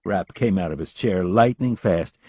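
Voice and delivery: ordinary delivery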